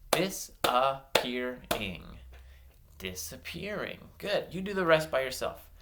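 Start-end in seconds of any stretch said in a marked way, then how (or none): 0.1-2.0 DISAPPEARING in slow, separated syllables. Shown as a demonstration. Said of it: "blend the syllables into one word". 3.0-4.0 DISAPPEARING as one smooth, softer word; blended smoothly. Shown as a demonstration. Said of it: "make them a little bit smoother".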